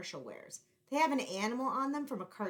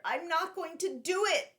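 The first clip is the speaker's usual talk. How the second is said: high, louder blurted resolve; 9 semitones higher, 7 dB louder than usual. Acted. Boy blurting his resolve aloud while racing Antonio. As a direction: burst out with it.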